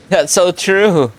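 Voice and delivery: ordinary talk, nothing marked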